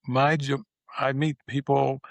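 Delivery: ordinary delivery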